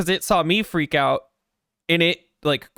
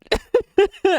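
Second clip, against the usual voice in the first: loud, high nervous laugh; high squeal laugh; 4 dB louder than usual. Real, not acted. Laughing at own fear.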